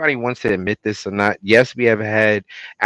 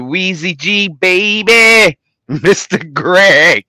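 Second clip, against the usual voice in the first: hype call; 6 semitones higher, 7 dB louder, slower than usual. Acted. Hyping up the producer.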